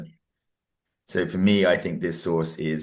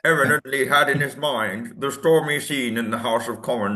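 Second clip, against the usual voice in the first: higher, louder, slowed-down statesman impression; Churchill; 7 semitones higher, 5 dB louder than usual. Student attempting Churchill's voice for a teasing colleague, hammed-up impression. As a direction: put on a loud, drawn-out orator's voice. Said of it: "how does his voice even go".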